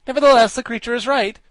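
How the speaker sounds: very high, loud character voice; high-pitched pronouncement; Wogglebug declaring. A narrator's reading. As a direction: declare it emphatically. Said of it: "declared the Wogglebug".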